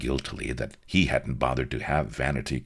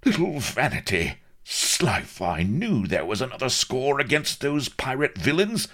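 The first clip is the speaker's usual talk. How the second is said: high, urgent blustering questions; agitated admiral; 9 semitones higher, 5 dB louder than usual. A narrator's reading. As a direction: demand news of your child. Admiral suddenly remembers missing daughter.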